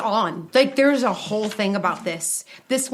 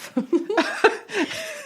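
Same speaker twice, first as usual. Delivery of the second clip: high giggle; 11 semitones higher than usual. Real, not acted. Laughs mid-sentence.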